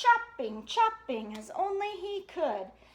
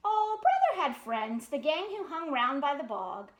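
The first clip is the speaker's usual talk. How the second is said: ordinary delivery, nothing marked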